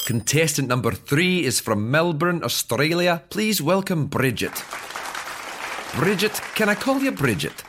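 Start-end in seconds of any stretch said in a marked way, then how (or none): 3.3-7.6 game show host. Acted. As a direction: play it like a cheesy quiz host.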